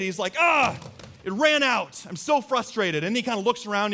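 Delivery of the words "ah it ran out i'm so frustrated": put-on high, loud whine of a frustrated kid; mock a little boy's exasperation; imitated frustration. Son whose squirt gun ran dry, frustrated. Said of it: "he got so frustrated".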